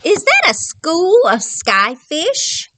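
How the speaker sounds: slow awestruck sea dragon; wondering sea dragon. Acted. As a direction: ask in awe, drawn out. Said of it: "he wonders".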